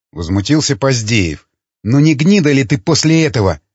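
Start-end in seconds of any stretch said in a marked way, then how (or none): none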